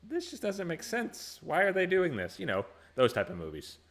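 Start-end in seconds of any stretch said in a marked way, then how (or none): 0.1-2.4 high, incredulous complaint; baffled moviegoer. Retold or imitated. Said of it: "Nicole and I were like".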